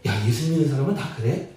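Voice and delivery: low, soft, quick admiring question; mildly impressed wondering. Shown as a demonstration. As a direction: ask in quiet admiration. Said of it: "선한 영향력을 끼치고 있다라는".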